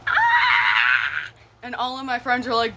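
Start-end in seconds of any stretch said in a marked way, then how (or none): 0.1-1.3 high, loud squeal of delight; excited squeal. Retold or imitated. Swooning over a boy's southern accent, loud high squeal. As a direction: squeal like a smitten teen. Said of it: "And I'm like".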